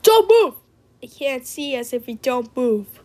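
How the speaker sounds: ordinary talk, nothing marked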